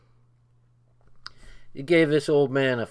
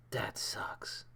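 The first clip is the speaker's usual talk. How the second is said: breathy whispered aside; whispered disappointment; 17 dB quieter than usual. Real, not acted. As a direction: whisper it to yourself. Muttered disappointment.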